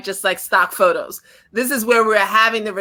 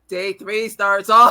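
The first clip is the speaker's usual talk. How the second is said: slow imitation of a dull lecturer; mock droning teacher; 3 semitones lower, slower than usual. Co-host teasing Ricardo's day three opening, mock teacher drone. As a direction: mimic him, dragging each word. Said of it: "like a teacher from Ferris Bueller".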